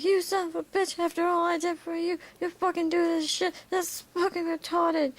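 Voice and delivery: high-pitched, fast angry rant; high, outraged character voice; Great One. Acted. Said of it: "He's like".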